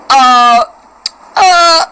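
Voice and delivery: loud, drawn-out mock stammer; caught-out "uh". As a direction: imitate someone caught out, stalling loudly. Daughter caught copying, mother imitates.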